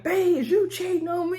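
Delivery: imitating a high-pitched jealous whine; mock hurt accusation. Coach accusing his wife of cheating, mock hurt. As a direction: whine it high, as the wronged husband.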